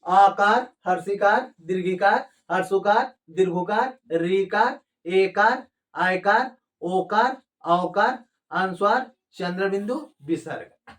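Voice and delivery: each name separate with a rising end; chant-like roll call from आकार, dropping on विसर्ग; list recitation. Shown as a demonstration. Teacher reciting the signs for learners, rising list intonation.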